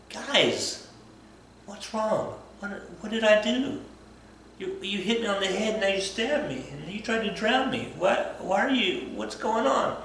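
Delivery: high, plaintive, hurt questioning; bewildered Rasputin. Retold or imitated. Rasputin asking the nobles what he did wrong, high and plaintive. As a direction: whine like a wronged friend.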